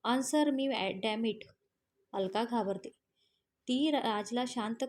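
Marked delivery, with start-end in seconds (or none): none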